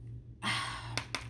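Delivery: long unvoiced breathy sigh; heavy sigh. Real, not acted. Reader sighs at the cards, heavy sigh. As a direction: let out a long tired breath.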